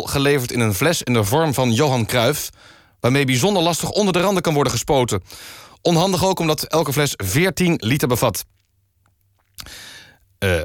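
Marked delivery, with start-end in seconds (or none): none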